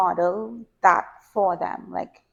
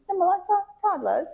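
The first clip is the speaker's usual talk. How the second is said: laughing; 10 semitones higher than usual. Real, not acted. Laughing comparison to toddlers.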